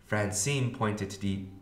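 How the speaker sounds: ordinary delivery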